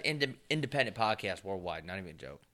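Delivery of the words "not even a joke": ordinary delivery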